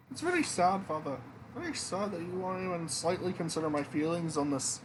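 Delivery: put-on a hurt young princess guilt-tripping her father; sulky princess voice. Princess sulking at the king, in a high voice.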